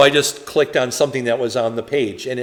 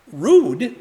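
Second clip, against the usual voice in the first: incredulous echo; 5 semitones higher, 4 dB louder than usual. Real, not acted.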